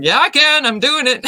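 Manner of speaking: loud, high mock defiance, breaking into a laugh; defiant comeback. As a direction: playfully insist against the doubters. Defiant comeback to imagined doubters.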